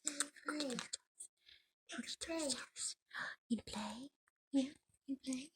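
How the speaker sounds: high, soft, slow pet talk. Real, not acted. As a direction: coo gently at the bird. Baby talk to bird.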